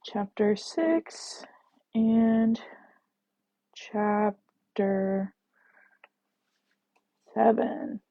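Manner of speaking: very slow, with long gaps between words; drawn out, distracted word by word; writing aloud. Real, not acted. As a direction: say each word as you write it. Saying it while writing it out.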